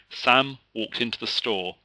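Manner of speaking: ordinary delivery, nothing marked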